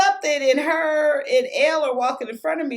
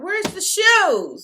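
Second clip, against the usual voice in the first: higher, louder, drawn-out mock demand; mock exasperation; 8 semitones higher, 5 dB louder, slower than usual. Mom searching the trunk for shoes, mock exasperated. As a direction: imitate an impatient mom, stretching the words.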